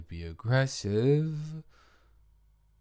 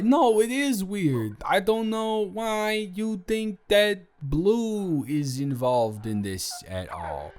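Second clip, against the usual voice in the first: usual speaking voice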